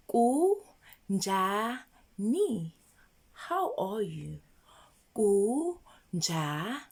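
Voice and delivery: Kunjani drawn out very slowly; slow model. Shown as a demonstration. Teacher slowly modelling kunjani for learners. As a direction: stretch Kunjani for them to repeat. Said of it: "Can you say it?".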